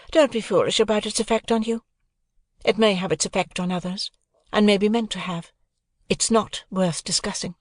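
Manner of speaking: put-on a proud young woman answering lightly; Estella voice. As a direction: play Estella, poised and aloof.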